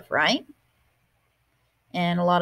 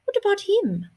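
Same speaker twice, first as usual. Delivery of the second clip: put-on much higher, coaxing old woman; sly grandmother; 13 semitones higher than usual. Grandmother nudging Martina toward the mouse. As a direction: point it out like a knowing abuela.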